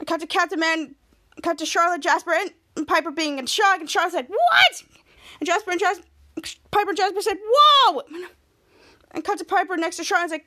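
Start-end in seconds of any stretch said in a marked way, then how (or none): 4.3-4.8 high, loud shocked squeak; stunned yelp; shocked what. Retold or imitated. Charlotte shocked by the news. 7.4-8.0 high, loud amazed whoa; astonished cry; shocked whoa. Retold or imitated. Piper and Jasper in shock.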